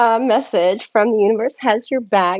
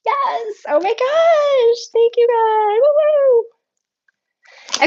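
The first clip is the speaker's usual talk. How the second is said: joyful outburst; 13 semitones higher than usual. Real, not acted. Reader overjoyed by the card, thanking God aloud. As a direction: shout your thanks to the sky.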